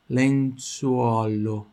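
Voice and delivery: lenzuolo drawn out, lips rounding on U and O; lenzuolo very slowly. Shown as a demonstration. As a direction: mouth every vowel of lenzuolo. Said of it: "Tem que mexer aqui". (lenzuolo bem devagar, mexendo a boca no U e no O)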